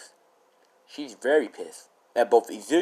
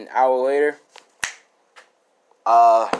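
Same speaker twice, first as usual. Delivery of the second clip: ordinary delivery, nothing marked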